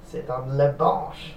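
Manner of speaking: slow, hammed-up French accent, "bench" said "bahnch"; mock French, draw out "bench" nasally; playful French accent on bench. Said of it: "Funniest word to say in a French accent".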